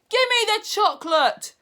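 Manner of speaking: put-on high, loud boy's shout. Bruno shouting at the witch, high and loud. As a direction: shout the demand. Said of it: "shouted Bruno".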